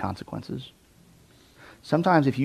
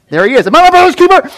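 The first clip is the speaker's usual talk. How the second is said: much higher, loud, nervous dodge; antsy and defensive; 14 dB louder, faster than usual. Retold or imitated. Guilty Cain dodging God's question, nervous and high. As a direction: sound like you got caught. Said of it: "the antsy".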